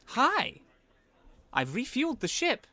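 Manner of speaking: high, loud little robot voice; little machine. Acted. Little machine reports to the player on the ship, high-pitched chirp. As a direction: squeak it out high, easing down at the end. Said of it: "the little machine that raised up".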